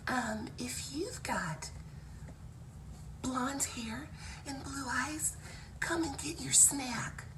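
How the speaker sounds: soft, high, breathy singsong whisper; imitating teacher. Teacher dismissing kids to snack, hushed high imitation. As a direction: call the children slowly in a hushed, high voice. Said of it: "So it would go like this".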